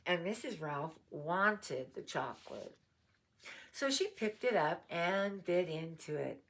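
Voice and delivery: plain reading voice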